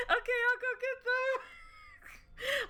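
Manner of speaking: high, slow, shouted through laughter; laughing squeal. Shown as a demonstration. Player answering as the eager minion, high laughing shout. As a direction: answer while cracking up. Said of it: "Okay, [name], go and get them".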